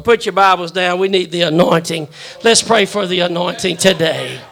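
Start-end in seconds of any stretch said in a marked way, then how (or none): none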